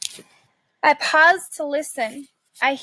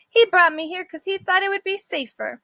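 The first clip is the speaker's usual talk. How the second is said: higher, haughty little dog voice; lightly voiced Snickers; 7 semitones higher than usual. Snickers answers the dog from her cage, higher and huffy. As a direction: answer with wounded pride.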